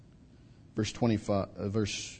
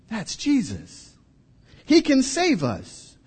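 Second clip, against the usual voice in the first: slow, much higher hopeful cry; 11 semitones higher, 3 dB louder, slower than usual. Acted. Frightened disciples spotting Jesus, slow rising hopeful cry. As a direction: call out in sudden hope.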